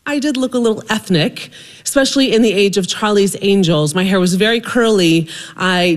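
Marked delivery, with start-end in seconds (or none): none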